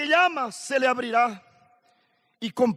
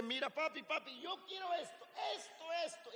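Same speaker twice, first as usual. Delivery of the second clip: put-on high, whiny little-boy plea, pitched up; begging child; 6 semitones higher, 16 dB quieter than usual. Preacher voicing his four-year-old son begging for a toy, whiny and pitched up.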